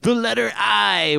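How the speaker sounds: ordinary talk, nothing marked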